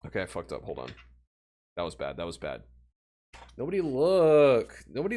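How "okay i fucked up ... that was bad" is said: much softer, lower grumble; soft low disappointed mutter; muttered dismay. Real, not acted. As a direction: mutter at the mistake. After failed trick.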